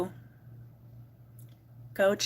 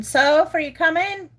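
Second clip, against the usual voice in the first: louder, higher call to the dog; 5 semitones higher, 7 dB louder than usual. Real, not acted. Owner calling her dog who is not coming, raised calling voice. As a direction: call across the house for your pet.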